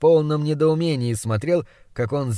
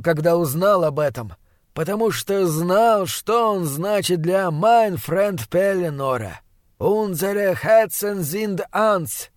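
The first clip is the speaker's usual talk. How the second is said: slow, higher-pitched, drawn-out grieving figure; solemn, emotional old Austrian; 4 semitones higher than usual. Acted. Austrian host remembers the boy's dead father, solemn and moved. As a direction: mourn with grave, slow emphasis.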